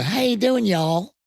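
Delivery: high, bright mock Southern "How you doing, y'all"; a lightly put-on Texan hello; Southern greeting. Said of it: "they go".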